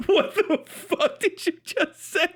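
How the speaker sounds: ordinary talk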